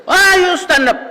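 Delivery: very high, loud shrill scolding shout; shrill angry professor. Retold or imitated. Professor scolding a student who stood up, shrill high shout. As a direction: screech at the student like an irate old teacher. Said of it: "boses, ano, siya, shrill".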